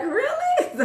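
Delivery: very high laughing "Really?"; mock disbelief, high and laughing; incredulous. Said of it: "And then I was like".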